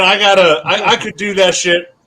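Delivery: ordinary talk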